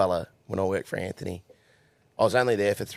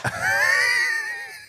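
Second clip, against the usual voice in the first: high shrieking laugh; 3 dB louder than usual. Retold or imitated. Laughing at his own pride.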